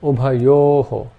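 ubhayoho said slowly, the final o echoed after a breath; ubhayoho, slow, with an aspirated oho; slow visarga demo. Shown as a demonstration. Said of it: "Some examples to illustrate this".

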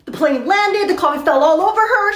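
ordinary talk, nothing marked

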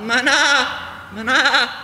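high, drawn-out bleating call of mana; bleating shepherd call. Retold or imitated. Shepherd calling his flock, high bleating call. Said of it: "in a kind of a bleating voice like that".

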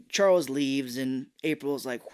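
ordinary delivery

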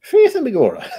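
high, hammed-up Irish brogue through a laugh; a cheesy stage-Irish line, laughing; bad Irish accent. Trying out accents, a laughing, hammed-up Irish brogue. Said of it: "It's not even a good Irish accent".

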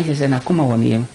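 ordinary delivery

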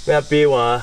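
normal talking voice

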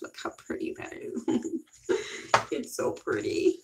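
breathy hushed marvel; whispering over how pretty the lipstick is; awed whisper. Real, not acted. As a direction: whisper it like a treasure.

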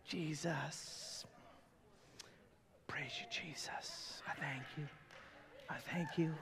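breathless whispered praise; hushed awed whisper. Retold or imitated. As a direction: whisper it in awe, barely voiced. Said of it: "the crowd's like".

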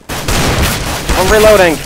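slow, high drawn-out plea; protesting call. Real, not acted. Gamer caught while reloading, drawn-out high protest. Calling out to attacker.

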